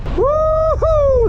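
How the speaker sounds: high, loud whoop into the wind; excited whoop. Real, not acted. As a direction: whoop with joy. Cheering in the wind.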